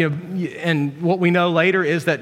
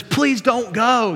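slow, higher-pitched plea; mock pleading; 6 semitones higher, much slower than usual. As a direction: drag it out like begging. Abraham begs the visitors to stay.

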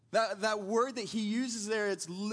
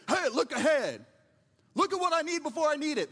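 raised, higher, fast voice of a father lecturing his son; stern dad instructing; 7 semitones higher, 6 dB louder than usual. Retold or imitated. Father at the salvage yard calls to his idle son, raised and brisk. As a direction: bark the advice like a strict dad on the job. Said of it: "And he said".

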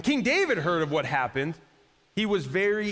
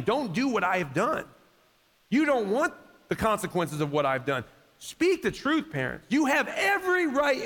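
higher, driving sermon exhortation; fervent preaching; 4 semitones higher than usual. Acted. Pastor urging parents to speak truth, fervent preaching. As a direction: push it home from the pulpit.

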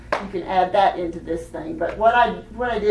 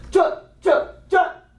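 short, punched syllables pushed from the body; voiced from down here; 8 semitones higher, 3 dB louder, much slower than usual. Shown as a demonstration. Teacher models the drum-line call, pushed from down below. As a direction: punch each syllable from down in the gut. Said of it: "like, from down here".

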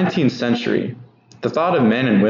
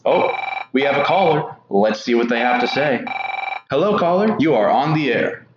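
booming prize announcer; 3 semitones higher than usual. Acted. Radio quiz show host skit.

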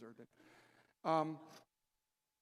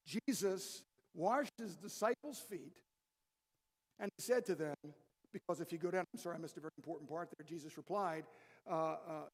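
ordinary delivery

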